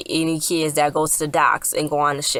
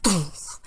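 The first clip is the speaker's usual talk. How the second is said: soft "doof" impact noise; imitated thud; 5 dB quieter than usual. Amy just went overboard, soft imitated thud. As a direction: mimic a dull thud under the breath.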